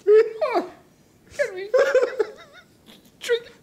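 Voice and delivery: high squeaky laugh through words; gasping with laughter; laughing hard. Real, not acted. Laughing fit over smelly beer.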